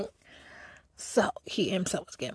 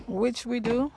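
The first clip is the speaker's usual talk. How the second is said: loud, higher restatement; emphatic admission; 5 semitones higher, 8 dB louder, slower than usual. Real, not acted. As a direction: say it back louder, lifting the end. Repeats the line for emphasis.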